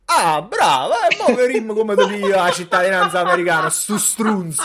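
put-on Neapolitan street accent; neapolitan street slang. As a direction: threaten in Naples dialect. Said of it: "ma tu hai detto nel podcast".

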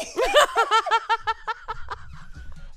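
long, high laugh at his own joke; laughing fit. Real, not acted. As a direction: crack up helplessly at your own nonsense. Cracks up at own gibberish.

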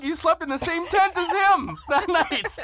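struggling not to crack up; laughing while telling. Real, not acted.

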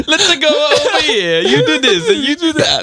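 very high, hammed-up Italian accent. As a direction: squeal it like a cartoon Italian. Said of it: "him having the heavy Italian, stereotypical".

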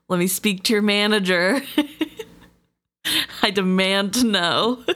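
hammed-up demanding Karen. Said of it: "this is a bit of a Karen moment".

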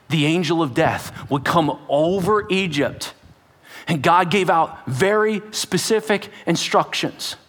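normal talking voice

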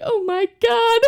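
high, far-off panicky squeak; panicked teen. Retold or imitated. Teen hears she needs x-rays, high and panicky. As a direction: squeak it out in dread. Teen self reacting to doctor.

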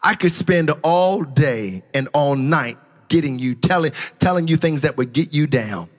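hushed sermon voice; low reflective preaching. Acted.

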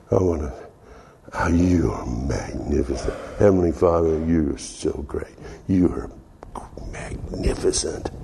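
low, hushed adoration, breaking into whisper; tender, intimate prayer. Retold or imitated. Worshiper in the heavenly Father's lap, tender hushed prayer. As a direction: murmur it softly as if cradling his face.

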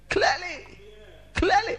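high, climbing repeats of "clearly"; rising emphasis. Acted. Preacher builds emphasis.

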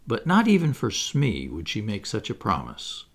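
plain reading voice

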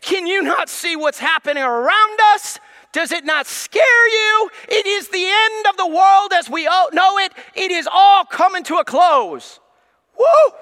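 loud, high panicky shouting; mock panicked shout. A worried churchgoer yelling at Pastor Dave in mock panic. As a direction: shout it like the world is ending. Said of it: "You may say".

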